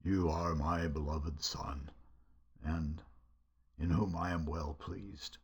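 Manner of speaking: deep, low imitation of God's voice, kept soft; low pretend boom, without the volume; booming authoritative voice. Shown as a demonstration. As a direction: play a solemn, authoritative voice from heaven, low and hushed. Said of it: "in a kind of booming, authoritative voice".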